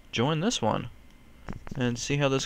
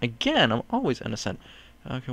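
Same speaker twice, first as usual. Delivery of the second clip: ordinary talk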